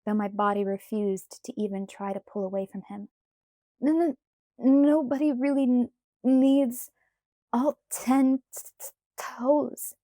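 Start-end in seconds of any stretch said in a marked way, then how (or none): 3.8-9.9 high, chattering stammer; shivering stutter. A narrator's reading. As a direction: stutter from the cold. Shivering Bella.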